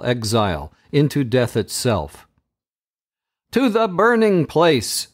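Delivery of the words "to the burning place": high, ringing command shout; enchanter's shout. A narrator's reading. Enchanter sentencing his rival, shouting high. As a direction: call out the sentence like a tyrant. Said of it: "shouts the enchanter".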